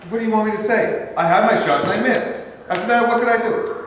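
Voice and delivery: high, loud frustrated protest; exasperated outburst. Acted. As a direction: throw the words out in frustration. Steve defends his failed career.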